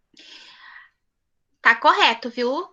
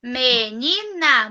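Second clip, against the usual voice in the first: slow repeat; 2 semitones lower, much slower than usual. Shown as a demonstration. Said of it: "teacher repeats word slowly".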